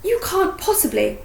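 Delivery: high, shocked protest. A narrator's reading. Hermione stopping Harry, shocked. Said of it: "sounding shocked".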